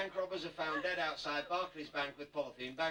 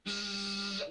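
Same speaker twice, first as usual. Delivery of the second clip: buzzsaw noise; 6 semitones higher than usual. Retold or imitated. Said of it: "worked in a sawmill with a buzzsaw".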